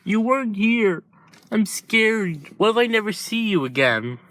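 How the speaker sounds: high, frightened little voice; pleading kid afraid to lose his brother; scared child. Acted. Boy clinging to Shizaki, high scared voice.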